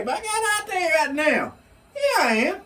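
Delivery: high-pitched, emphatic comeback; mock proud retort. Acting out proud reply to accusation.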